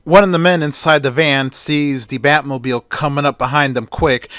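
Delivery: plain reading voice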